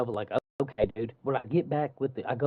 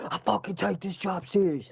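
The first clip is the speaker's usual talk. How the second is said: higher-pitched, indignant imitation of a coworker yelling; retold angry yell; 8 semitones higher than usual. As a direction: mimic him blowing up, pitch jumping up. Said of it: "Who the fuck you yelling at".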